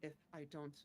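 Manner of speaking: low, soft and measured in-character voice; solemn adventurer character. Acted. Player speaks gravely in character.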